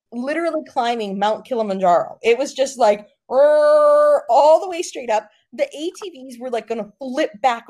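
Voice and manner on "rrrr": loud, drawn-out "rrrr"; loud mimicked motor growl; imitated engine rev. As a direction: rev like a straining ATV engine. Imitates ATVs climbing straight up.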